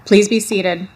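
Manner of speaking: loud announcer call. Real, not acted. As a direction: announce it over the PA. Announcer to audience.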